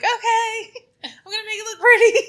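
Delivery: high, teary laugh over the words; laughing through tears. Retold or imitated. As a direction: force some cheer while fighting back tears. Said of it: "I'm trying not to cry".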